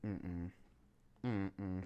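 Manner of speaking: soft, low hums of no; quiet refusal. Retold or imitated. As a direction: hum a calm no while pointing to the door. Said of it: "I was literally just like".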